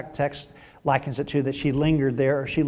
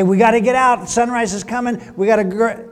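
raised, pressing voice; urgent hurrying; 7 semitones higher, 6 dB louder than usual. Retold or imitated. Angels to Lot before sunrise, urgent hurrying. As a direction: hurry them out the door.

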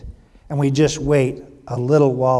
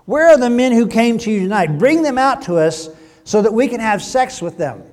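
raised, higher voice, calling to the house; 7 semitones higher, 7 dB louder than usual. A narrator's reading. Men of Sodom to Lot, calling out. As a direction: read it as a crowd's called-out demand. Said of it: "They called to Lot".

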